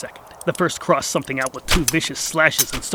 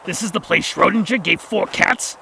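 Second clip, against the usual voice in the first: higher, louder indignant character voice; demanding; 5 semitones higher, 4 dB louder than usual. Acted. The Gax challenging his travel guide, loud and indignant. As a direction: demand it like a cheated tourist. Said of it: "demanded the Gax".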